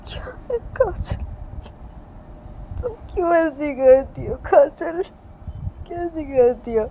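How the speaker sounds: high, drawn-out tearful wail; crying. Acted. Reshma cries alone over Kajal hurting herself, sobbing. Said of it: "Reshma फिर से रोने लगती है".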